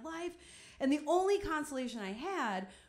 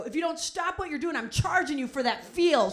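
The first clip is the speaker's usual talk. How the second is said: raised, loud threat to a stranger; stern warning; 4 semitones higher, 7 dB louder than usual. Retold or imitated. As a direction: turn around and warn her loudly. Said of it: "I go".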